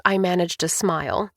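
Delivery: ordinary delivery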